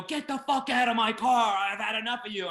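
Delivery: high, fed-up outburst, acted out; retold angry yell. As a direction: re-enact throwing someone out of your car. Retold screaming match in car.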